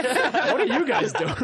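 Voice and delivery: high, loud, slow mock cop; incredulous cop. Cop finding a carful of kids, laughing mock disbelief. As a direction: imitate a baffled cop through laughter. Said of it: "So imagine you're a cop".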